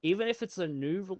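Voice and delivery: ordinary talk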